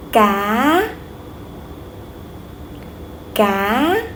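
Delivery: "Cá" gliding up at the end; rising tone. Shown as a demonstration. Teacher models a word to repeat after her, rising tone. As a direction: lift each "Cá" like a question. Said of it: "raise your voice a little bit".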